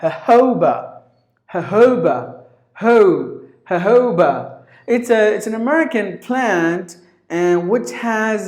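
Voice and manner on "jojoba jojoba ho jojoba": "Jojoba" with a loud HOH in the middle; "Jojoba" repeated, leaning hard on "Ho"; stress second syllable. Shown as a demonstration. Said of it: "give the stress on the second syllable".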